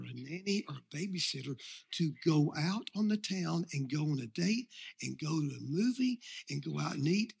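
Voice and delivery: soft, hushed voice of a devil luring you in; tempter's whisper. Acted. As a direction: whisper it like the devil in your ear. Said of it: "what they're usually gonna whisper to you".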